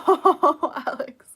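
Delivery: loud laugh into a greeting. Real, not acted. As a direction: burst out laughing. Laughing at chat.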